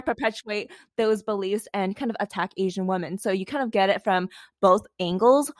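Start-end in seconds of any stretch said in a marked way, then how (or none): none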